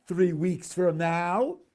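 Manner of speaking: THREE WEEKS FROM NOW hit loud; selective emphasis. Shown as a demonstration. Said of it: "selectively emphasize the words".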